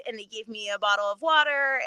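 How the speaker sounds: ordinary talk, nothing marked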